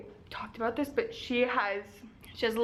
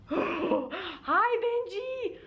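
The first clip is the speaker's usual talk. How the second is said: high squeal of delight; excited dog greeting; 8 semitones higher, much slower than usual. Real, not acted. Greeting her dog Benji, squealing with delight. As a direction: gush over the dog, voice jumping up.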